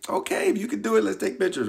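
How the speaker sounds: lightly voiced mom getting the camera; imitating mom.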